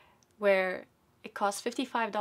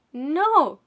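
high and loud, indignant; a mother snapping back at the driver; defiant loud no; 7 semitones higher, 13 dB louder than usual. Retold or imitated. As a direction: fire back hard. Mom's loud reply to driver.

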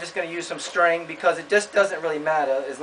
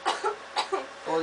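coughing; 6 semitones higher, 8 dB quieter than usual. Real, not acted. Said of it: "Dust kinda gets to me".

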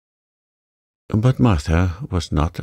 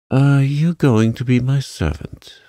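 high, louder imperious demand; 7 semitones higher, 5 dB louder than usual. Acted. Mary questions the new housemaid, imperious. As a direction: talk down to her like a servant. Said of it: "still in her imperious little Indian way".

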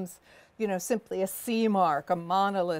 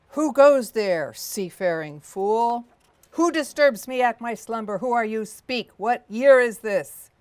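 grinding stone statue; 3 semitones higher, 9 dB louder than usual. Acted. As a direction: wake as an angry stone giant. The giant wakes and challenges.

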